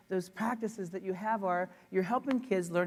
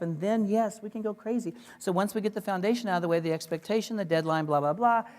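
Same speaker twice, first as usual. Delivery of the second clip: usual speaking voice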